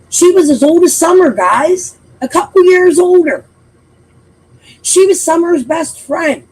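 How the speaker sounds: nearly yelling at the viewers in disbelief; hot, loud, high-pitched indignation; worked-up outburst. Real, not acted. Heated rant about Journey's danger.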